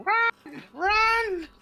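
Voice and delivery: high, loud goblin shriek. Acted. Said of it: "in a goblin voice".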